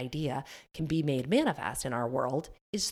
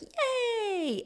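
high, sarcastic little yay; a tiny hollow cheer; mock cheer; 3 dB quieter than usual. Business owner at cart opening, mock cheer.

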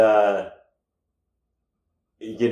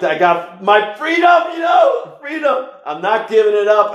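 defiant excitement; 11 semitones higher, 6 dB louder than usual. Real, not acted. Defiant over keeping his freedom.

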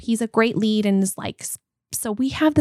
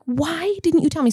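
higher-pitched retold indignant question; retold ticked off; 6 semitones higher than usual. Retelling confronting husband over the dress, mildly ticked off. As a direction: plead in mock exasperation. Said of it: "I was so ticked off".

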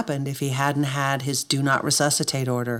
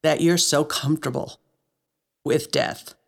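choked up. Real, not acted. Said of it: "it's gonna make me wanna cry".

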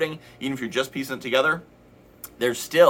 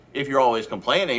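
normal talking voice

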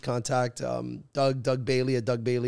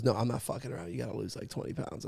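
quiet; 4 semitones lower, 11 dB quieter, faster than usual. Retold or imitated. Agent's stern warning, voiced.

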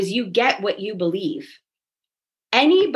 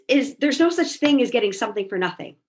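ordinary talk, nothing marked

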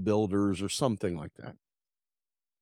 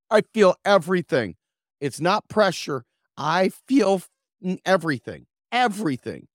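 higher and louder, stammering in panic; panicked protest; 6 semitones higher, 6 dB louder than usual. Retold or imitated. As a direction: blurt it out as the pain hits. Panicking in the dentist chair.

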